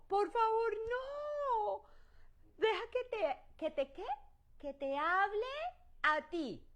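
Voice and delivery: high, pleading voice begging Moses; pleading people. Acted. Israelites begging Moses to let God speak only to him, pleading. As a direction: beg high and loud, like scared people. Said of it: "Y el pueblo, ¿qué dijo?".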